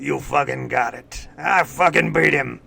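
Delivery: loud, growled trainer impression; Mickey from Rocky. Host answering how Jimbo's dialogue sounds, gruff trainer impression. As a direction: bark it like an old boxing coach. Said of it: "I said Mickey from Rocky".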